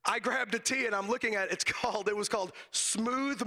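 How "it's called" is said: ordinary delivery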